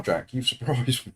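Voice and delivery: ordinary talk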